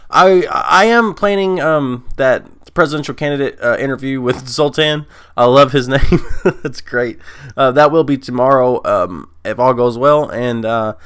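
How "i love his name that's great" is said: words through a laugh. Real, not acted. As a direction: chuckle while you say it. Laughing about Zoltan's name.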